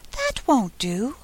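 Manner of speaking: sweetly insist, taking it slowly; soft, sweet fawn. A narrator's reading. Fawn urging Alice to think again, soft sweet voice. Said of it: "Such a soft, sweet voice it had".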